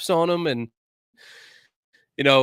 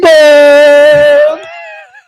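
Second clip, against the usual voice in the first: high, loud whooping laugh that rises; loud burst of laughter; 19 dB louder than usual. Real, not acted. Host cracking up at his co-host's greeting, loud whoop of laughter. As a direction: explode into laughter at your buddy.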